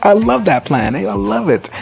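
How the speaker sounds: high-pitched, gushing approval; retold squeal of approval; mock delight. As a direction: gush it, imitating a delighted schemer. Repeats the character's delighted approval.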